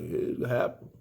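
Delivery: mumbled, enthusiasm-free mock reply; unenthusiastic. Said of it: "Where the fuck is your enthusiasm".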